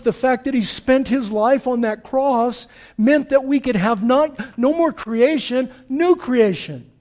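loud, high, building sermon cadence; preaching climax. Acted. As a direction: drive the new creation home. Builds to a full-force climax.

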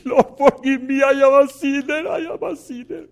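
high, loud imitated crying prayer; retold crying plea. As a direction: cry out for forgiveness, re-enacted. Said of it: "he started crying".

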